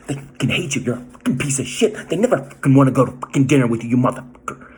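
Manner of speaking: vicious inner voice screaming insults; voicing the inner demon. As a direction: snarl the abuse like the voice in your head. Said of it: "That disorder's like".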